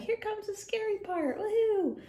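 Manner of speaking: giddy excitement before a scary movie bit; thrilled kid. Acted. Boy and dog watching a movie, excited squeal. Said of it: "the little boy and his dog is like".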